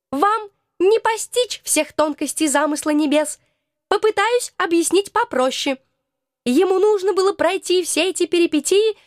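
a hint of a prim little being; small creature voice. Krokotuk explains.

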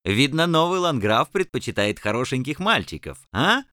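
высокий глумливый голос; издевательски, с подначкой; глумливый рыцарь. Acted. Рыцарь издевается над ланграфом. (глумливый голос рыцаря)

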